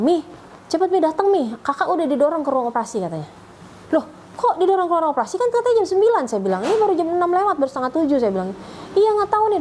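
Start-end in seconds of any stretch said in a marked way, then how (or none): 0.0-3.0 higher-pitched imitation of a pleading child; retold tearful urgency. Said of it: "dia telepon nangis-nangis". 3.9-6.2 high-pitched, incredulous questioning; retold startled protest. 6.7-8.2 higher-pitched, puzzled objection; retold incredulous protest. Retold protest about the early hour. 9.0-9.6 high-pitched imitation of an upset child; retold tearful reply. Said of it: "udah nangis adiknya".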